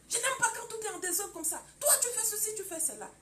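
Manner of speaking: high, loud, complaining husband; angry husband. Acted. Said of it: "Ne crie pas". (mari fâché qui crie, voix aiguë et forte)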